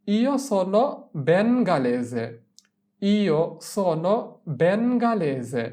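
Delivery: very slow, careful Italian; slow model phrase. Shown as a demonstration. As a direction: say it slowly for repeating. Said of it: "teacher models pronunciation".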